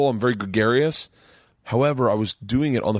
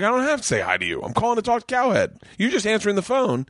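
pitched up, quick and indignant; defensive retort; 11 semitones higher than usual. Retold or imitated. Comic retelling his defensive snap at a receptionist, indignant. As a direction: bristle back at her in retold defence. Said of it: "then I got defensive".

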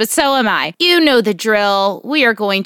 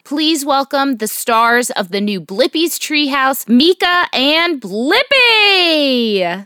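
big, building welcome with stretched names; show announcer; 5 semitones higher, slower than usual. Acted. Podcast host welcomes Blippi and Mika, excited announcer. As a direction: announce the stars, drawing out the last names high.

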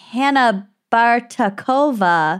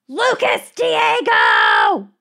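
shouting a name; much slower than usual. Acted. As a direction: yell the name across the house. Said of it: "this is in all caps".

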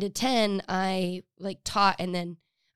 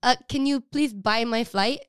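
higher Filipino mom imitation; Filipino accent; 4 semitones higher, 3 dB louder than usual. Friend mimics mom asking for a flight, Filipino accent. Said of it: "she'll go like this".

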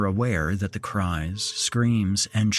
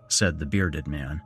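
ordinary delivery

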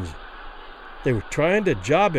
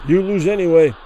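blunt; 6 dB louder than usual. Acted. Haggerty backing Kelly.

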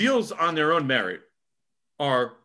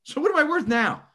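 much higher, imitating a player pressing his case; mock indignant demand; 12 semitones higher than usual. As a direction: throw it back as a high, pointed challenge. Player's imagined demand in contract talk.

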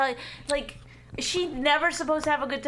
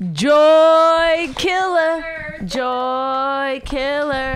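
loud, very slow sing-song jeer; drawn-out taunt; 2 semitones higher, 5 dB louder, much slower than usual. Real, not acted. Wife heckling the husband, loud drawn-out taunt. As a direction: belt it out, stretching every word. Louder chanted taunt at dad.

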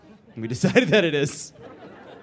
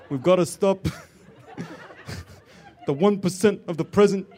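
lightly voiced Bernie Sanders; a hint of a Brooklyn Bernie rant; Bernie Sanders impression; 3 semitones higher than usual. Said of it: "bad impression of James Adomian doing a good impression of Bernie Sanders".

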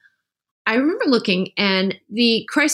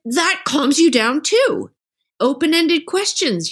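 normal talking voice

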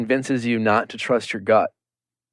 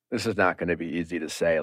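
low, quiet and quick; hushed and grave; 7 semitones lower, 8 dB quieter, faster than usual. Retold or imitated. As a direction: break the bad news softly. Clayton voiced breaking bad news.

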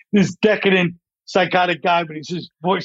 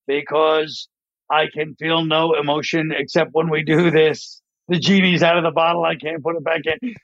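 deadpan monotone drone; Ben Stein. Acted. Ben Stein character answering his pleading wife, emotionless monotone. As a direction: answer without any feeling, all on one level. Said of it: "so monotone".

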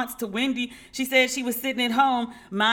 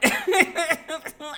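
laugh; 8 semitones higher than usual. Real, not acted. Laughs at own need for therapy.

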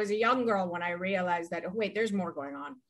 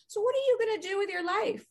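higher-pitched posh English dad voice, lightly done; English proper accent; 13 semitones higher than usual. Retold or imitated. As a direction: imitate a proper English father asking gently. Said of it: "in his English proper accent".

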